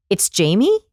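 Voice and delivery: "Jamie" rising sharply, as if asking; question inflection. Retold or imitated. Imitating a founder's first words, questioning rise. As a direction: turn "Jamie" into an unsure question. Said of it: "Like that, he asked it".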